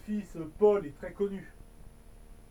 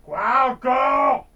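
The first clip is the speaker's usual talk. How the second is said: exasperated shout; 9 dB louder, much slower than usual. Acted. Octopus disturbed again.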